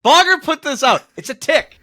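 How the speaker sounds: high, loud laughing rant; laughing protest. Real, not acted. Cohost defending his cough to producer, laughing high protest.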